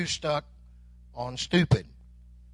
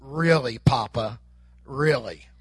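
drawn-out, lower "Really, Papa? Really?" like an exasperated grandkid; a light imitation of grandkids rolling their eyes; mock disbelief; 10 semitones lower, slower than usual. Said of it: "my grandkids say it like this".

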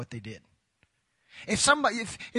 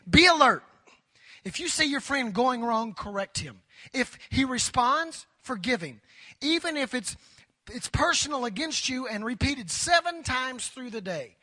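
scripture reading; 4 semitones higher than usual. Acted.

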